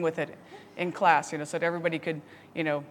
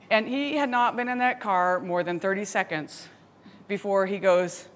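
normal talking voice

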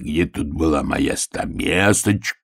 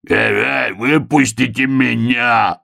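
howling; 5 semitones higher, slower than usual. A narrator's reading. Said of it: "завыл волк".

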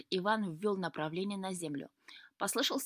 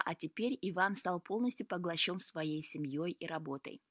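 ordinary delivery

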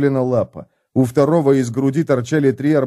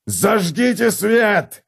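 roaring; 8 semitones higher, much slower than usual. Acted. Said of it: "ревел Готрек".

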